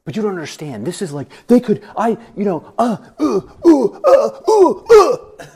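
flustered protest climbing into high, loud sputters; worked-up ranting. Retold or imitated. Husband protesting to his wife, worked-up mock rant.

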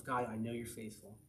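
soft, low murmured prayer; reminding oneself. Acted. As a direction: say it quietly to yourself. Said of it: "you gotta remind yourself".

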